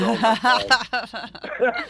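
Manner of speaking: oh my God, laughing it out high and slow; a stunned laugh that stretches every word; laughing disbelief. Real, not acted. Meeting his lost brother, laughing in disbelief.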